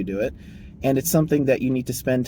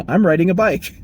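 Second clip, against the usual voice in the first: matter-of-fact worker; 6 semitones higher, 6 dB louder than usual. Retold or imitated. Worker answers boss.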